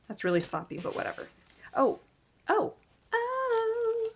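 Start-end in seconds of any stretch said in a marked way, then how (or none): 1.7-4.1 surprised oh. Real, not acted. Startled by something in the box.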